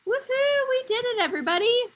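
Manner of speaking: high, loud cheering; excited cheer. Real, not acted. Celebrating right answer with class.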